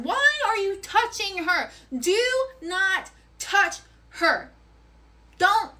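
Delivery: each word of "Why are you touching her?" spaced out, high and hard; furious slow warning. Retold or imitated. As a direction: lay down the law, word by word. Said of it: "And I'm like".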